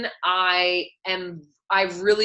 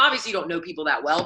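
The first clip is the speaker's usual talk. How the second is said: ordinary talk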